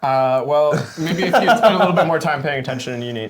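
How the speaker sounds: loud teasing put-down; mock condescending. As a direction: talk down to him playfully, loudly.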